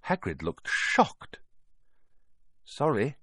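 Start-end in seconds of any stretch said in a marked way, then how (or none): 2.6-3.2 put-on slightly higher, hurried boy's apology; quick apology. A narrator's reading. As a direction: apologise in a hurry. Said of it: "Harry said quickly".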